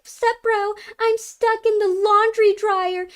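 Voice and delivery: put-on high, slow helpless girl voice; hammed-up helpless stepsister; imitating the stepsister. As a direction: whine it up high like a damsel stuck. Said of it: "she's like".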